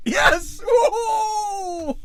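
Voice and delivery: high whooping victory yell; triumphant cheer. Real, not acted. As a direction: shout it out like you just won big. Winning a roll, cheering.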